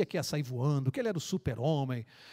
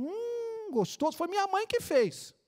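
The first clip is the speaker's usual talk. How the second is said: put-on old man savoring; 14 semitones higher, slower than usual. Old man tastes sandwich.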